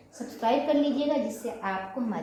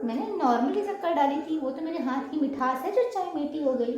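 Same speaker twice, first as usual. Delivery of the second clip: higher, teasing brag about her sweet hand; playful boast; 6 semitones higher than usual. Pretend. To a guest over too-sweet tea, a playful high brag. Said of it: "तो बस simple सा बोलो".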